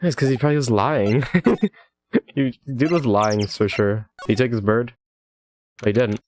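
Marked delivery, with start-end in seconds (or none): none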